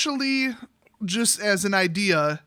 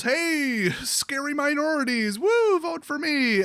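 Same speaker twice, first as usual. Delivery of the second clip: high, hammed-up pandering voice, slowing at the end; mock a showman working the crowd; mocking Trump; 8 semitones higher, slower than usual. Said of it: "Trump goes".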